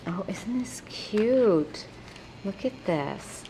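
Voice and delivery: slow, hushed delight; whisper. Real, not acted. Admiring the stamped gift, whispering. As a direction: breathe it out in awe.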